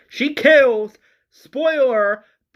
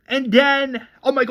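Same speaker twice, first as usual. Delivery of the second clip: usual speaking voice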